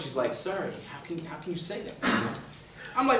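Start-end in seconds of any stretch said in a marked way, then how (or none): 0.3-1.9 much softer, hushed disbelief; quiet voice. Retold or imitated. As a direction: drop to a soft, stunned question. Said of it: "in this sort of quiet voice".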